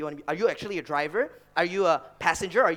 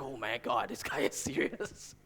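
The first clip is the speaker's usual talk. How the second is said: softer, breathy whispered shock, slow; awed whisper; 6 semitones lower, 7 dB quieter, slower than usual. Retold or imitated. New hire reacting to the CTO at lunch, stunned whisper. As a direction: whisper it like you just saw the boss get serious. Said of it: "I was like".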